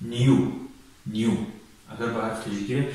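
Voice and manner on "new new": New said slowly and clearly as nyoo; careful English new. Shown as a demonstration. Teacher modelling New for Tajik learners, clear and deliberate. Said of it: "نیو".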